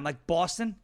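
ordinary delivery

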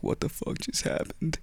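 whispered in disbelief; whispered retold panic; shaken whisper. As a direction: breathe it out in shock. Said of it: "I was, like, shaking".

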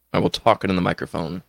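ordinary talk

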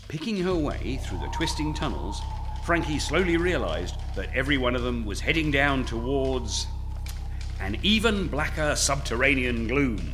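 dramatic storybook narration; story narration. A narrator's reading. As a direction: read it like an adventure novel. Reads his story aloud.

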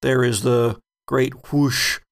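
ordinary talk

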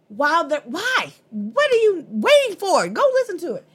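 high, loud exasperated urging; on a high horse, excitedly pushing the listener; worked up. Real, not acted. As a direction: demand it in disbelief, voice shooting up. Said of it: "I keep getting excited".

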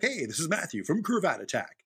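high, loud channel greeting; big, bouncy intro voice; video host intro. Retold or imitated. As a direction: announce it like a video opener. Quoting their own video intro.

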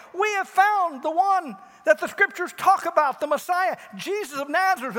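high, loud eager announcement; excited news. Retold or imitated. Philip telling Nathanael, excited high announcement. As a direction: announce it bursting with joy. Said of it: "Philip goes and tells Nathanael".

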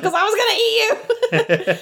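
high, laughing playful voice; mock confession. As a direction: toss it off with a giggle. Michael's quote, high, laughing.

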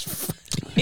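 breathy whispered curse, no voice; a mock whispered fuck you. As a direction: hiss the curse under your breath. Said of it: "He says".

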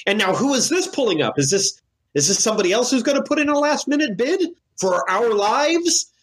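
high, theatrical auction-room taunt; hammed-up auctioneer, high and dramatic; Doctor Walker. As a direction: stir up the room, pitch soaring, stretching the last words. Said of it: "Doctor Walker is going to ... say".

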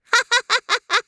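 high, tinny mechanical laugh; evenly spaced robotic "ha ha ha"; gloating high-pitched bird laugh; villain's cackle. A narrator's reading. Villain bird gloats after threat.

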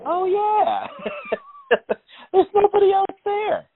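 high-pitched laughing aside to himself; laughing mock realization. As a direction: squeak out the sudden realization while laughing. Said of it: "no part of my brain was like".

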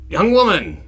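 high, loud put-on call; King Arthur hailing a peasant. King Arthur corrects himself to peasant woman, loud hail. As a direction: correct yourself in a mock-regal shout. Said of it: "King Arthur comes up to these peasants".

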